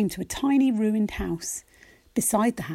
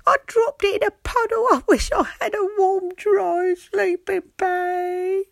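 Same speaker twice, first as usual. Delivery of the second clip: high, small sad fox voice; a high, forlorn little fox; 10 semitones higher, 4 dB louder than usual. Acted. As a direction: squeak it out as a chilly little fox. Fox character voice.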